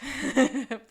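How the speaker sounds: short real laugh; a quick laugh. Real, not acted. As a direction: laugh at yourself.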